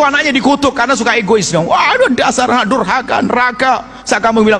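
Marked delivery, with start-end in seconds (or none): 1.5-4.0 mother cursing. Retold or imitated. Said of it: "Jadi ibu juga begitu".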